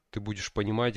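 normal talking voice

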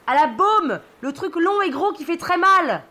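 high-pitched call from far above; yelled from aloft. Acted. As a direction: call down as if from up the mast. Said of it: "me hurle de tout là-haut".

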